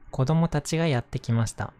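plain reading voice

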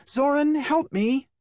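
very high squealing call for help. A narrator's reading. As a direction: squeal it out, half laughing. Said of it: "She squealed".

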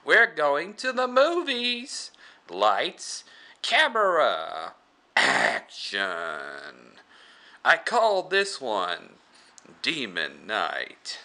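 slow, theatrical horror showman; Crypt Keeper. Acted. Horror host launching his film, slow showy announcing. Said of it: "the Crypt Keeper".

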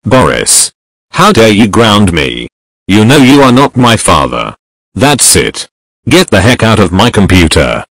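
very loud mock tirade; mad outburst. Raging at Boris for grounding me, very loud mad outburst. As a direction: blast out stuttered ohs, then a booming telling-off. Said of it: "I got so mad at Boris".

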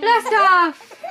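loud playful launch call; excited blast off. Pretend. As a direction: shout it like a rocket launch. Rocket play launch with baby.

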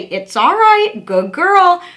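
gentle sing-song pet talk, unhurried; calm reassurance. Shown as a demonstration. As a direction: soothe a squirming puppy. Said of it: "Just keep calm, say".

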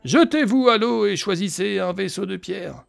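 slow, measured reading of ancient lore; game narration. A narrator's reading. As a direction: read it like a solemn storyteller. Reads game text aloud.

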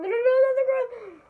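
high, rapid string of refusals; alarmed protest. Real, not acted. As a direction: blurt it out fast and high, stopping someone. Alarmed at wrong button.

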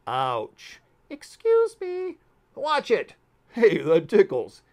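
high, loud squeaky complaints; crowded creature yelps. Acted. Creatures in cramped oceans yelling at each other. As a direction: yelp as if jostled in a packed crowd.

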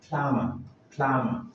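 plumber said with a silent b; model pronunciation. Shown as a demonstration. Teacher modelling a commonly mispronounced word, plumber with silent b. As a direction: say "plumber" as PLUM-er, no b. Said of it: "words you're probably mispronouncing".

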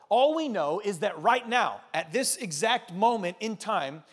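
emphatic sermon peak, slow and loud; preaching at full force. Acted. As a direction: drive the point home from the pulpit. Preaching climax to congregation.

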